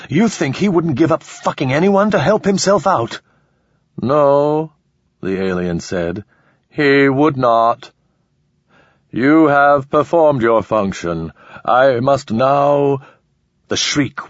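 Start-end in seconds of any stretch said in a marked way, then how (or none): 0.1-3.2 high, raging desperate plea; furious and cornered; desperate rage. Acted. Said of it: "expressing itself in rage". 4.0-4.7 alien voice. Acted. 6.7-13.0 very slow cold alien verdict. Acted. Alien decides.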